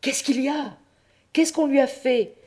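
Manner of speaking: put-on high, alarmed old pope crying out. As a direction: cry out in a high startled voice. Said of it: "s'écria le bon pape". (le pape s'écrie, affolé, d'une voix aiguë)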